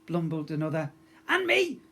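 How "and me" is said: very high, louder quick voice; fleeing guard. A narrator's reading. Second guard fleeing the hall, high voice. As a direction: squeak it running off.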